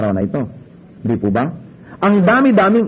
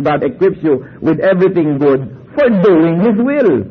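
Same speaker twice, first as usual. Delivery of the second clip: scripture reading; slower than usual. Shown as a demonstration. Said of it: "Kasi sabi ng Hebrews thirty-one".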